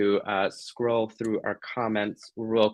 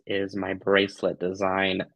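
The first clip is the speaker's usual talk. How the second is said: ordinary talk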